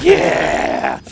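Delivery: high, loud mock nerd cheer; hammed-up frenzied fan yell; foaming-at-the-mouth nerd. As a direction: imitate a rabid chat crowd yelling. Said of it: "foaming at the mouth".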